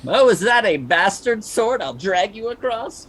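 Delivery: high, loud mocking voice; mock inner voice. Mocking the dragged captive, high and loud. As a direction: squeak it out as the dragged body.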